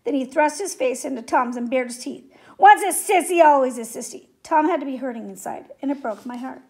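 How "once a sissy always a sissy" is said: bullying jeer. A narrator's reading. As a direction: throw it forcefully in his face. Bully taunts in Tom's face.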